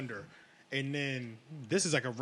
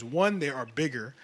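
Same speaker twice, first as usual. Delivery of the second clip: ordinary talk, nothing marked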